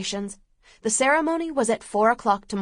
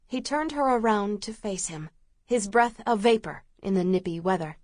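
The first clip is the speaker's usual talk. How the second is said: plain reading voice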